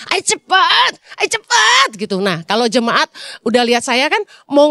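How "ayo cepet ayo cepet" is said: usual speaking voice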